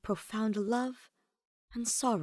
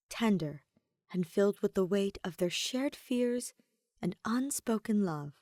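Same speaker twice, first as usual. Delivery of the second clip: plain reading voice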